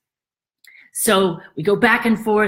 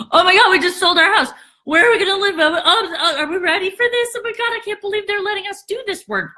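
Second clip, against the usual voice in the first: giddy panic; 11 semitones higher, faster than usual. Retold or imitated. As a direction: blurt it out overwhelmed.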